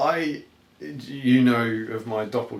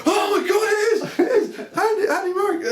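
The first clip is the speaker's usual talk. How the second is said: much higher, louder excited squeal; excited kids; 5 dB louder than usual. Retold or imitated. Kids on bikes thinking they've spotted Andy Murray, excited squeal. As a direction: imitate a thrilled kid spotting a star. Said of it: "they went".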